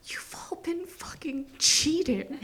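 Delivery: slow, soft whisper of disbelief; hushed amazed whisper; awed whisper. Retold or imitated. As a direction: breathe it out in wonder. Awed realisation on lithium.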